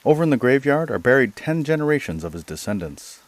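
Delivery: mild exclamation. Acted. Gentleman to the ragged questioner from the mountains, mildly exclaiming. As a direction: exclaim without a full shout. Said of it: "and then he shouted".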